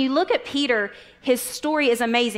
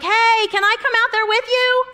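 high, loud eager call across the water; 11 semitones higher, 7 dB louder than usual. Retold or imitated. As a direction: shout it out like an excited fisherman. Peter calls to Jesus on water.

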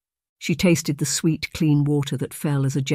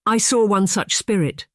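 higher excited exclaim; excitedly; 6 semitones higher than usual. Acted. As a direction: burst out with it, thrilled. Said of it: "she said excitedly".

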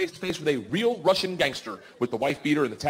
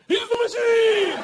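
much higher, louder, slow chant; group cheer; 6 dB louder, much slower than usual. Retold or imitated. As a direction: chant it like a drunken crowd. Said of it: "they're like, "Fuck it".

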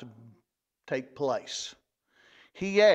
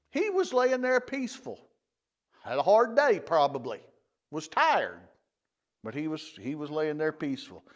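ordinary talk

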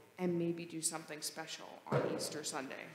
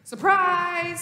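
high, loud gleeful cry; excited shout; 10 semitones higher, 13 dB louder than usual. Shown as a demonstration. Pastor showing children an excited word, loud and high. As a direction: burst out like a happy surprise. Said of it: "things we say when we're excited".

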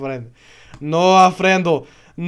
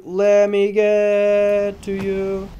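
slow strained plea; 3 semitones higher, much slower than usual. Real, not acted. Straining to reach dragon.

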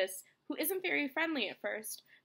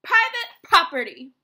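very loud, higher warning shout; angry yell; 4 semitones higher, 14 dB louder than usual. A narrator's reading. Grumpy cactus Hank warns off a tortoise, yelling. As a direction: yell at a trespasser. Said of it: "yelled Hank".

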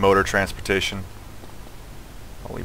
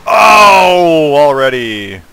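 loud, high wail that falls away; dismayed outcry; 5 semitones higher, 13 dB louder, much slower than usual. Real, not acted. Player groans at a sudden setback, loud dismay. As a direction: cry out in dismay, then sink.

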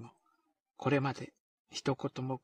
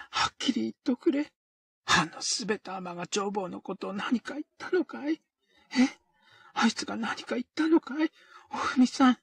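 higher, louder, pressing hard; eager demand; 7 semitones higher, 6 dB louder than usual. Acted. O-kei eagerly demands the truth from O-fumi. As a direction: push her for the answer. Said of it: "意気込んで聞いた".

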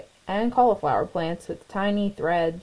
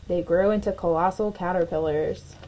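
ordinary delivery

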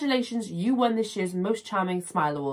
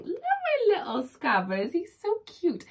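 cooing to pet; 10 semitones higher than usual. Real, not acted. Fond of her pet rat, she coos in a high baby voice.

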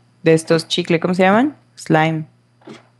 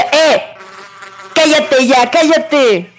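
angry yelling; 4 semitones higher than usual. Real, not acted. Gamer yelling at a hissing snake, aggressive. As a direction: yell it down like you're fed up. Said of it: "Ando muy agresiva".

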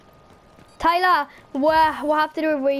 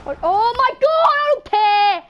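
higher and louder exasperated cry; excited outburst; 6 semitones higher, 5 dB louder than usual. Real, not acted. Reacting to Tyler's laugh, loud and exasperated. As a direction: blurt it out, worked up.

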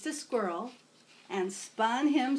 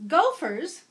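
higher, louder alarmed cry; cried out; 6 semitones higher, 7 dB louder than usual. Acted. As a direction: cry it out in dismay.

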